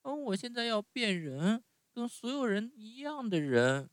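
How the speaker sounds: 高高的童声，急切地要求; 像小孩一样迫切地嚷; 木偶大声嚷着. Acted. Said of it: "大叫着说". (用很高的童声急切地叫)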